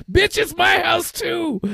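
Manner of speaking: high, slow mock yell; retold shout. Husband recalls yelling back at his wife, slow high shout. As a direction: bellow it back at her, drawn out. Quarrel, his own retort.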